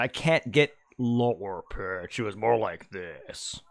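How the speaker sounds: slow, put-on deep voice; mock deep voice, drawn out. Shown as a demonstration. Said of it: "that was me faking it".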